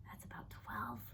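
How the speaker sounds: a dry, breathy whisper; whispered aside. A narrator's reading. Narrator whispering a wry aside to listeners. Aside about father's count.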